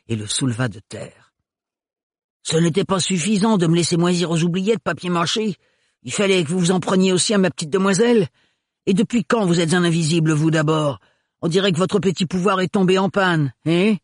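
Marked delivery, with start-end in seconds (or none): 2.4-14.0 heated sneer; angry taunting. Acted. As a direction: taunt him while holding him up. Renard confronts Philibert angrily.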